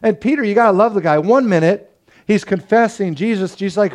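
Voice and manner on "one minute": ordinary talk, nothing marked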